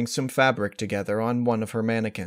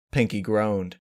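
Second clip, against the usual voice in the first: ordinary delivery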